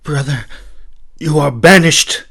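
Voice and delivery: loud, high royal decree; stern princess. Acted. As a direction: pronounce the banishment firmly. Princess banishes her brother.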